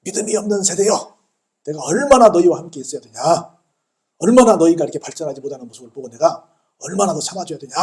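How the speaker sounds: loud, exasperated scolding in a retelling; stern rebuke. Retold or imitated. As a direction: rebuke them like a fed-up teacher. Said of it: "막 책망을 하십니다".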